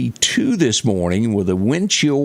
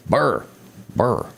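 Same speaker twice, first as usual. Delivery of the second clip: playful brr, slightly higher; mock shiver; 3 semitones higher than usual. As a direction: pretend to shiver from the cold. Imitating shivering at cold record.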